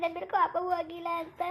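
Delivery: usual speaking voice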